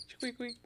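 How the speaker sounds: high squeaky mouse noise; little squeaks way up high. Acted. Voicing the caught mouse.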